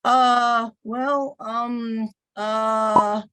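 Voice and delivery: drawn-out fake hesitations, um and uh; hammed-up filler words. Teacher fakes nervous fillers for the coach demo. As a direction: stall on purpose like a nervous presenter. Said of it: "deliberately gonna do some things I shouldn't do".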